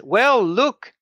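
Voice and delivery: put-on high old woman's voice, surprised and pleased; old lady welcoming visitors, high and cheery; old lady greeting. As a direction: greet the guests like a delighted grandmother. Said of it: "she says".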